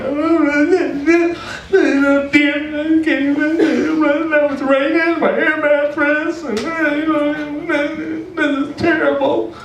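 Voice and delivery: high-pitched whiny mock sob; imitating himself crying like a kid. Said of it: "and I'm starting to cry".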